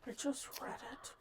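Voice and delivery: hushed, near-whispered frustration; muttering to oneself. Real, not acted. Co-host racking her memory for the word, muttering to herself. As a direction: say it under your breath, voiceless.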